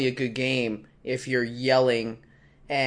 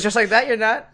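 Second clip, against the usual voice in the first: high, louder doubting retort; sceptical jab; 9 semitones higher, 4 dB louder than usual. Retold or imitated. Desk guy doubts the well-dressed player, sceptically. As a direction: call his bluff, mockingly. Same line retold.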